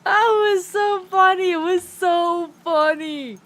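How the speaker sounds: can barely speak for laughing; helpless laughter. Real, not acted. Telling the viewer about Beef's anger, helpless laughter.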